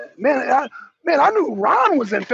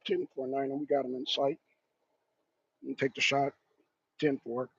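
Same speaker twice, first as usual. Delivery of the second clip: much lower, much softer radio chatter; calm commando radio; 11 semitones lower, 12 dB quieter than usual. Acted. Commandos confirming the target, low calm murmur. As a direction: murmur like a sniper on comms. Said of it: "commandos are gonna be like".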